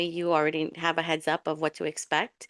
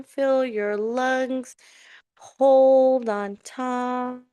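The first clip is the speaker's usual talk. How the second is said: slow, drawn-out meditation voice; sustained, chant-like calls to hold and release; guided breathing; much slower than usual. Real, not acted. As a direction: lead a breath, stretching each cue long. Leads a breathing exercise.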